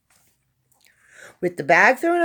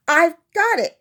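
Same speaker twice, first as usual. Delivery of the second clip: chuckled; 10 semitones higher, 4 dB louder than usual. Acted. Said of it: "he chuckled".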